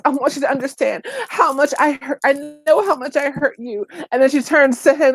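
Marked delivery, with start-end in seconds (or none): 0.0-3.9 higher-pitched imitation of a tearful apology; mock tearful apology. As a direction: mimic a crying apologizer, lightly. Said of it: "she's crying and she's in tears".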